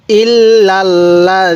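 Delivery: drawn-out Arabic with doubled L held; slow Quranic recitation. Shown as a demonstration. Teacher models the word for students, slow recitation. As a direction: recite it slowly for students to repeat. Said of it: "আমার সাথে সাথে পড়বেন".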